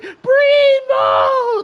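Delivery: very high loud strained shout; mock wrestler yell. Mocking Brie Bella's ring shout for the stream, high and loud. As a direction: ham up her ring cry. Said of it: "she'll be like".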